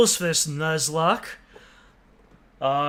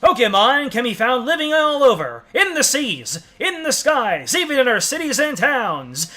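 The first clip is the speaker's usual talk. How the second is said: higher, formal news-anchor read; newscaster voice; 6 semitones higher than usual. Acted. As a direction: announce it like a TV anchor. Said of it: "so I'm using my newscaster voice".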